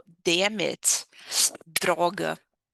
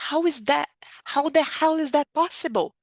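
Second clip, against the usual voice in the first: strong indignation; 6 semitones higher, 4 dB louder than usual. Shown as a demonstration. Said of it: "a intenção da frase é ser forte".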